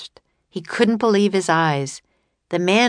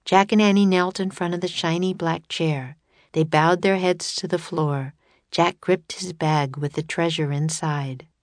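usual speaking voice

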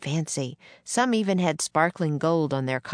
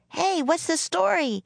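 much higher, perky reporter-style question; girl playing newspaper reporter; 12 semitones higher than usual. A narrator's reading. Annie questions the man like a newspaper reporter, high-pitched. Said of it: "sounded just like the newspaper reporter".

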